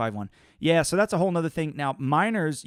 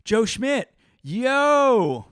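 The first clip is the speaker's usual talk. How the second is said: excited; 10 semitones higher, 8 dB louder, much slower than usual. Real, not acted. Greeting friend in chat.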